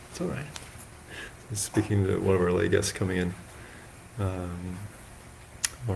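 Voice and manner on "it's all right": hushed, higher-pitched reassurance; soft aside. Real, not acted. Monk to a lay guest coming in, soft high aside. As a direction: say it softly off to the side.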